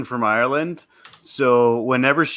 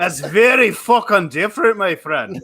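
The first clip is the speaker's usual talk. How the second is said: higher, hammed-up Scottish retort; Scottish accent; 4 semitones higher than usual. Shown as a demonstration. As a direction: protest in a thick put-on Scottish accent. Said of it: "this is Scottish".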